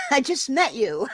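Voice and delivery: laughing through a mock-indignant reply. Real, not acted. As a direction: giggle out the words. Laughing retelling of a misunderstanding.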